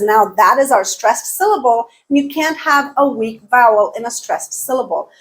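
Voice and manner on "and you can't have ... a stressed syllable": ordinary delivery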